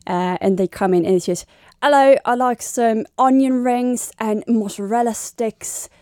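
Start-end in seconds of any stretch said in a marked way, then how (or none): 1.8-5.9 slow, imitated English customer's accent; English tourist accent. English tourist ordering at a fast food restaurant, imitated accent. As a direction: put on an English accent, drawn out. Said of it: "I only pick up their accent".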